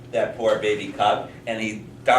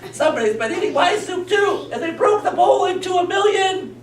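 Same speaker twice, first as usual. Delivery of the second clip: very high, mock-bawling child; crying little girl; 5 dB louder than usual. Little girl finds soup eaten and bowl broken, hammed-up wail. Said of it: "the little girl's like this".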